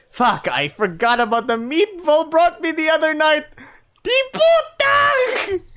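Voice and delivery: high, loud alarm rising to a squeal; panicked cursing. Acted. Narrator realising the food is in sight, panicked.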